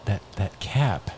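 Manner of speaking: quiet golf commentary; soft, low deadpan banter; color commentator. Acted. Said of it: "You're the color commentator".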